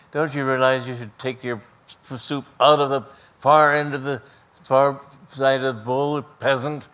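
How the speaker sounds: put-on loud, scornful bully taunting a smaller boy; sneering bully. Bully at the table mocking the new boy, loud sneer. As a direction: bark it down at him like a big bully.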